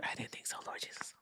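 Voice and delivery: unvoiced hush, very soft; hushed disbelieving whisper to the viewers; whispered aside. Real, not acted. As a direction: whisper it like a secret to the chat.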